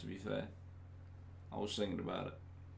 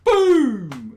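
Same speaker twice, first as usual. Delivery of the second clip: much higher, louder yelled boom; catchphrase shout; 14 semitones higher, 14 dB louder than usual. Retold or imitated. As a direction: bellow it like a bid catchphrase. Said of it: "he used to go".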